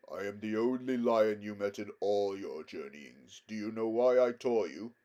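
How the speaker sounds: solemn, stately lion voice; majestic lion, grave. A narrator's reading. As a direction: speak with calm regal authority. Aslan questions Aravis.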